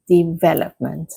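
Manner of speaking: development stressed on VEL; careful model development with the beat on VEL; second-syllable stress. Shown as a demonstration. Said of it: "stress is on the second syllable".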